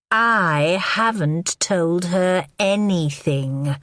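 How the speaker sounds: very slow, every word given weight. Acted. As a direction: lean on each word slowly. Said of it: "She spoke slowly, giving the words extra weight".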